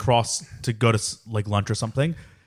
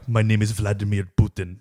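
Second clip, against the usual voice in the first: low-pitched fake Russian accent; mock Russian accent; 5 semitones lower, 3 dB quieter than usual. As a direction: put on a deep Russian accent. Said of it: "I could make fun of a Russian accent".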